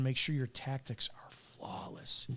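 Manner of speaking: dropped to an intense whisper. Real, not acted. Ex-cop coaching young officers, intense whisper. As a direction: lean in and whisper the advice.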